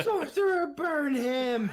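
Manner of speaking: very high, loud battle cry; shouting. Acted. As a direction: yell the command dramatically.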